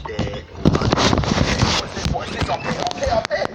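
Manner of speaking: loud frantic calling. Real, not acted. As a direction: rush the words loudly.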